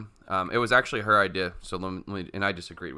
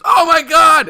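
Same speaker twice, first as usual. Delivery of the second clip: very loud, high mock scream; horrified shriek; 18 dB louder, slower than usual. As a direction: shriek it out as her shocked reaction. Acts out client's horrified discovery.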